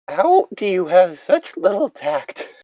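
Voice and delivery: usual speaking voice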